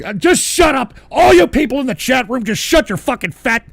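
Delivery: real exasperated outburst; angry shouting. Real, not acted. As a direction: yell at the whole chat room. Orders chat room to shut up.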